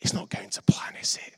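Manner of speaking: unvoiced whispered tease; teasing whisper. Onlooker teasing someone whose work is failing, whispered. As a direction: whisper it like an innocent bystander. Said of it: "and goes".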